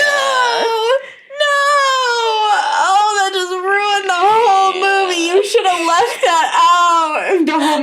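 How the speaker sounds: crying out no, no; anguished wail. Real, not acted. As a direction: wail like the story broke your heart. Upset at sad story twist.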